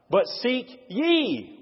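"ye" drawn out high and slow; stress on ye. Shown as a demonstration. Preacher showing what emphasizing a word reveals, stressing "ye". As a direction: stretch and lift "ye" above the other words. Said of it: "If I emphasize ye".

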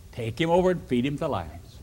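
ordinary delivery, nothing marked

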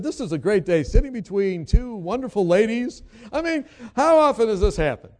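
loud, high-pitched retold enthusiasm; exuberant delight. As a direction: gush to two seatmates, hammed up. Retelling his greeting to seatmates.

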